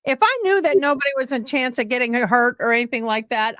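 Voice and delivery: normal talking voice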